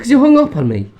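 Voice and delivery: high, loud indignant protest. Real, not acted. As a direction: squeal it out accusingly. Mock accusing, heated bickering.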